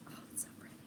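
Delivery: whispered in soft awe; awed whisper. Real, not acted. Holding up her new yarn for viewers, an awed whisper. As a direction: breathe it out, admiring the yarn.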